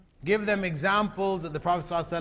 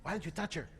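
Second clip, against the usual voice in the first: hushed, quick tempting murmur, as if into an ear; whispered temptation; 10 dB quieter, faster than usual. Acted. Satan tempting a boy alone with a girl, acted as a hushed whisper. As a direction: softly imitate a whispering tempter. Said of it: "Satan is there whispering in the ear".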